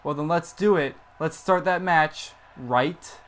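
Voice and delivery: normal talking voice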